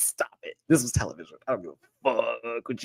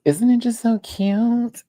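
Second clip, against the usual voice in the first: high gushing; 11 semitones higher, 6 dB louder, slower than usual. Real, not acted. Showing gift brushes.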